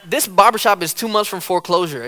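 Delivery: ordinary delivery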